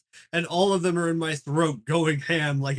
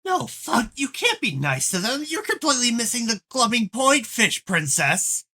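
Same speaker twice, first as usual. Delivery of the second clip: angry; 7 semitones higher than usual. Acted. Troll yelling at teammate.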